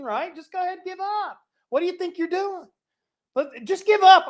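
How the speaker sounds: high, loud swaggering taunt; mock Assyrian bragging at Jerusalem; boastful Assyrians. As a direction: strut it like a bully army. Preacher voicing boastful Assyrians.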